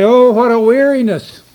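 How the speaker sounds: a loud, scornful imitation of weary grumbling; sneering weariness. Preacher voicing people who sneer at God's table, mock weary. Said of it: "and you sneer at it".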